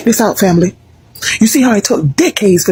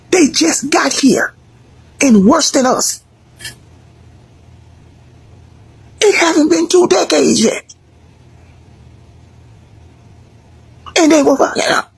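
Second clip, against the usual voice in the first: worked-up rant; 8 semitones higher than usual. Real, not acted. Ranting to viewers about immigrants, pitched up and emphatic. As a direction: drive it home, voice climbing.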